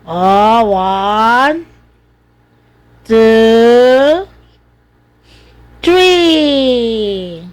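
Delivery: long, stretched numbers, building; drawn-out count. Real, not acted. Cheering on baby with a count. (pahabang pagbibilang na pampalakas-loob)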